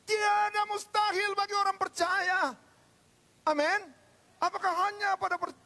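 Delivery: high, loud proclamation; full-force pulpit call; preacher's rousing shout. Real, not acted. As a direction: cry it out to the congregation. Rousing cry to congregation.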